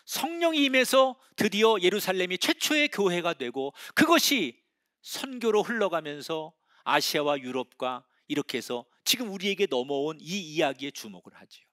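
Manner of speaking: ordinary talk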